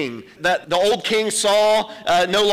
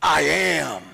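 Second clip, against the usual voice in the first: booming royal proclamation; ringing shout of authority; powerful kingly declaration; 4 semitones lower than usual. Retold or imitated. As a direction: announce it like a king claiming power. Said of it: "That's a king with some power".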